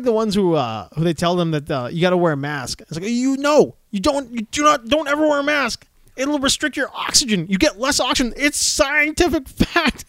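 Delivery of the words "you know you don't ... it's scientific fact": higher voice, sneering certainty; mocking an anti-masker's fake science, smug; mock know-it-all. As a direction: play a smug anti-masker lecturing, pitched up.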